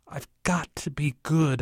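whispered inner voice; anxious thought. A narrator's reading. Child at the silent table after the scolding, whispering inwardly. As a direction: whisper it to yourself. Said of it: "I think".

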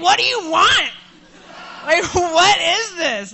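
high, loud, exasperated yell at the car; furious outburst. Retold or imitated. Driver yelling at the check engine light, furious. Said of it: "I was furious".

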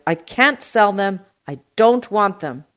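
loud shout, leaning on the refusals. A narrator's reading. Produce man refuses uncle's shipment, shouting. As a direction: shout each refusal firmly. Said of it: "D'Agostino shouted".

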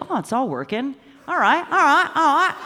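high, laughing "all right, all right" cheer; giddy retold relief; mock relieved. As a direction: pump yourself up, half laughing. Retelling self-check after the crash.

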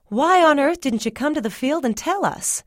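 high-pitched, exasperated mama; scolding mama. A narrator's reading.

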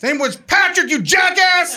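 high-pitched, loud angry shriek; imitating an outraged sister yelling; sister's yelled outburst. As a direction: yell it out like an uncontrollable heckle. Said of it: "my sister's funeral Tourette's kicked in".

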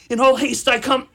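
loud and high; a passionate, breathless declaration; urgent cry. Acted. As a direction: shout it as you run. Romeo rushing to Juliet.

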